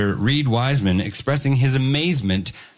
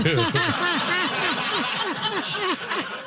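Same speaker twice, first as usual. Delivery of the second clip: big laugh; 4 dB quieter than usual. Real, not acted. Laughs at accent joke.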